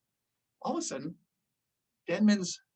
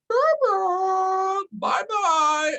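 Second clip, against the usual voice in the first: much higher singsong goodbye; taunting bye-bye; 12 semitones higher, 4 dB louder, much slower than usual. Retold or imitated. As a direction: wave him off, gloating. Taunting friend floating away.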